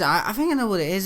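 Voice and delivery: ordinary talk, nothing marked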